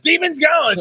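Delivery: high-pitched exorcist's cry; mock evangelist. Husband teasing his wife, mock evangelist exorcism. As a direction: cast out demons like a revival preacher. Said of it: "he goes full evangelist on you".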